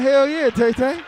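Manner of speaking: high loud eager yes; eager exclamation; excited. Retold or imitated. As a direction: shout it like a kid offered a treat. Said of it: "I'm like".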